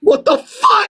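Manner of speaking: very high, incredulous outburst; outraged yell. Real, not acted. Outraged at insult about ex-girlfriend.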